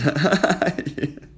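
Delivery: loud high burst of laughter; big bursting laugh. Real, not acted. Flirty livestream banter.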